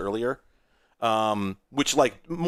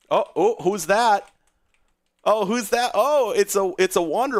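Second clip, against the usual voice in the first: excited surprise; 9 semitones higher, 5 dB louder than usual. Real, not acted. Surprise at a character appearing.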